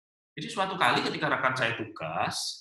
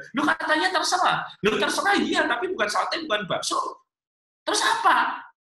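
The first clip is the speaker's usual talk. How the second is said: very high, loud mock squabble; hammed-up protest, pitched right up; exasperated bickering; 14 semitones higher, 4 dB louder than usual. Husband and wife arguing over where to eat, exasperated. Acted couple quarrel escalates.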